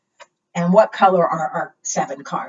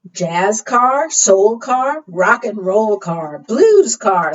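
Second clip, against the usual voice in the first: rhyming read-aloud; 2 semitones higher, 5 dB louder, much slower than usual. Acted. Reading rhyming car book aloud.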